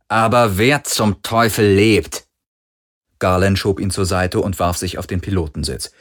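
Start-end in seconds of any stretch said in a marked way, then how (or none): 0.1-2.3 slow, loud, exasperated demand; grumpy. A narrator's reading. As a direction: lean grumpily on each word. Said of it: "sagte Parker mürrisch". (mürrisch und laut, gedehnt)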